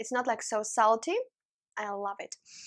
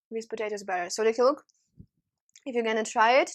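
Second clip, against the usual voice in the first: ordinary talk, nothing marked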